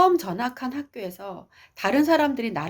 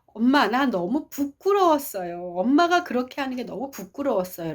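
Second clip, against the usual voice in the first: higher, earnest child's voice, retold; child confessing feelings; 5 semitones higher than usual. Daughter explaining her embarrassment to mother, higher and earnest. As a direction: tell mother plainly, drawing out the feeling. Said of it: "자기 마음을 정확하게 얘기했어요".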